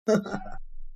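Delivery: short, soft voiceless laugh; quiet amused chuckle. A narrator's reading. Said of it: "هشام ضحك".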